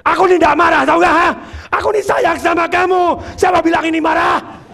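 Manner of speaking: furious high-pitched shouting; angry denial. Shown as a demonstration. Trainer acting a spouse who denies being angry, furious shouting. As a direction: yell the loving words as if in a family fight. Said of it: "Yaitu marah".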